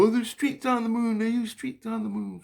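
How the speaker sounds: very high, sing-out child impression; imitating kids. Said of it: "And then they go".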